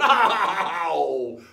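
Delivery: loud scoffing pirate guffaw; jeering laugh. Acted. Other pirates mock the boaster, loud jeering laugh. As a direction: roar a derisive "oh". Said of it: "And they went".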